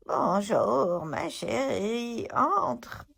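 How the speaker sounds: put-on a wolf straining to sound like a little old lady; wolf faking grandma. A narrator's reading. Wolf in bed fooling the girl, fake granny voice. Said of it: "essaya d'imiter la petite voix de grand-mère".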